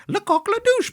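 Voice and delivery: falsetto French buffoon, very high; high-pitched French voice. Retold or imitated. Clown showing a chicken picture, high-pitched French voice. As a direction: imitate a squeaky French clown. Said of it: "in this high-pitched voice".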